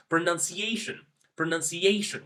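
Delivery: word stress demo; stress on ciation. Shown as a demonstration. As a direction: lean on ciation in pronunciation. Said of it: "I'm putting more pressure on the word ciation".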